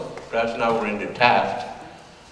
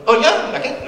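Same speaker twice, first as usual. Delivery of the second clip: put-on much higher, quick boyish protest; eager kid's reply; 13 semitones higher than usual. Young Louis protesting to his speech teacher, high. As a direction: bounce it up like an excited teenager.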